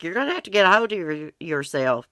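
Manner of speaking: urgent quoted scolding, briefly acted; imitated stern talking-to. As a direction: lightly act out someone telling you off. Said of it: "Mark's like".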